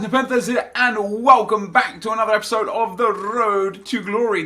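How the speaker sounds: high, loud YouTuber intro; hyped intro. Acted. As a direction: greet the viewers with big energy. YouTube intro greeting to viewers.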